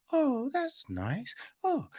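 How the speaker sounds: slow, high, dreamy mock approval; sappy mock approval. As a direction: coo it like a gullible girlfriend. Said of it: "she's like".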